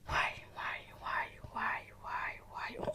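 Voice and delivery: whispered yeses; breathy whispered agreement. Real, not acted. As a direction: murmur yes under the breath.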